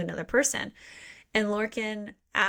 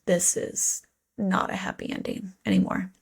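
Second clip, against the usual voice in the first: dismayed whisper. Retold or imitated. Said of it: "And you're just like".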